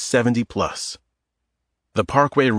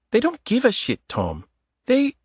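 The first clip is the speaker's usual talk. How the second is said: put-on higher woman's voice with a light Eastern European accent; 13 semitones higher than usual. Adita teasing Tom in the grocery aisle, Eastern European accent. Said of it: "In a light Eastern European accent".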